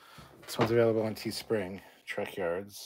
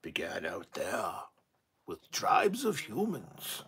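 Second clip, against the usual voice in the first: dramatic opening narration; 2 semitones lower, slower than usual. Acted. Fan reciting a sci-fi show's opening, slow dramatic narration. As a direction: intone it slowly like an epic prologue.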